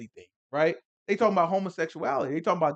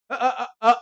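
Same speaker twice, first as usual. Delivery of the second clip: high, loud, nervous stammering cover-up; flustered Lot; 11 semitones higher, 11 dB louder than usual. Retold or imitated. Lot nervously answering the mob at his door. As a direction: caught off guard, stammer a cheery excuse. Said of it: "That's what Lot said".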